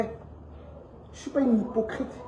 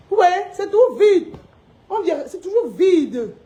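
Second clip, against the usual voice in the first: triumphant showing off; 7 semitones higher, 4 dB louder than usual. Real, not acted. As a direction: gloat while pointing at the shelves. Showing empty shelves, triumphant.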